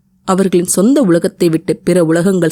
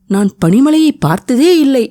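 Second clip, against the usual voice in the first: higher, delighted exclamation; wonder and joy; 7 semitones higher than usual. A narrator's reading. Girl seeing snow for the first time, delighted wonder. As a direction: marvel at the first snowfall. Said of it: "வியப்பும் சந்தோஷமும் போட்டி போட".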